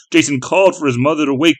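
ordinary delivery

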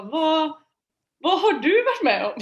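imitating friend's laughing disbelief; astonished question. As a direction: mimic the friend's wide-eyed shock.

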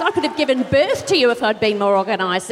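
ordinary talk

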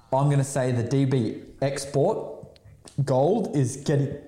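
words pushed out around burps; talking through a burp; talking while burping. Real, not acted. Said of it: "I'm burping all over the place here".

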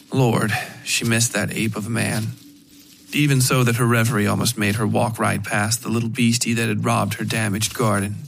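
usual speaking voice

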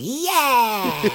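high whooping cheer; a big delighted whoop from the crowd; fist-pumping yell of yeah. Retold or imitated. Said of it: "he's just going".

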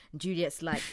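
ordinary talk

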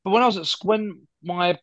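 ordinary talk, nothing marked

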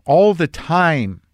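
aching, slowed-down emphasis; emphatic repeat. Real, not acted. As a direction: lean on "all the time", stretching it. Emphatic repeat of own phrase.

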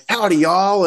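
high, hammed-up Southern American howdy; mock Southern American accent. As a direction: play a cartoon Southern American greeting. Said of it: "all these weird accents of ridiculousness".

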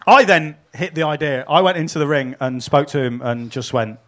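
normal talking voice

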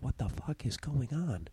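silently mouthed, almost no sound; mouthing it silently across the massage room. Retold or imitated. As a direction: mouth the words with only a trace of breath. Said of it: "we mouth".

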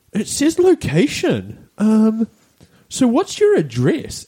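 high, slow, drawn-out clueless voice; ditzy shop worker. Retold or imitated. Ditzy clerk working through a return form, high and slow. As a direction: stretch the words out high, as if puzzling at the screen. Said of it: "This is the ditzy one".

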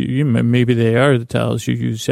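ordinary delivery

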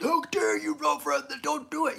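high, indignant mock scolding; mock younger self. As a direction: mimic your old self-righteous scolding. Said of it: "And I was like".

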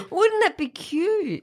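cooing over cute. Real, not acted. Gushing over tiny quail eggs.

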